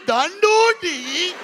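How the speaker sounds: high, slow, drawn-out Indian lilt; mock Indian accent. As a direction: stretch each D-word in exaggerated Indian accent. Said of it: "Indians will put a D on anything".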